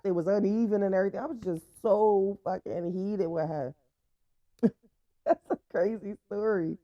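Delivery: ordinary talk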